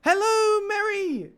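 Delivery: thrilled welcome shout; excited greeting. Real, not acted. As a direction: call out a delighted hello to a newcomer. Greeting a viewer joining the stream.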